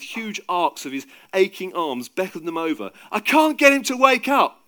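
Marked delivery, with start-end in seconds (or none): 3.1-4.7 loud, high, frantic cry; desperate, strained-with-effort appeal; panting desperation. A narrator's reading. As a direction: cry out exhausted for help. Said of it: "Peter panted".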